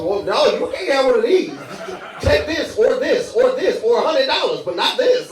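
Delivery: high, loud, flustered refusal; hammed-up frantic old-timer; protective old person. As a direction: push other things on them to guard the candy. Old person refusing, offering other things.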